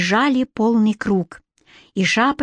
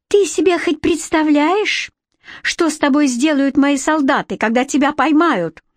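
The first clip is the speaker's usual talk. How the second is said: high-pitched angry queenly threat; 6 semitones higher than usual. A narrator's reading. Furious queen warns captor about her soldiers, angry threat. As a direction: threaten indignantly in a raised pitch. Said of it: "разозлилась не на шутку".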